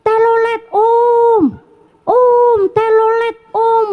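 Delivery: put-on high, slow child-like yell of om telolet om; high drawn-out chant at the bus; calling out. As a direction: shout to the passing bus like an excited kid. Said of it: "sambil teriak".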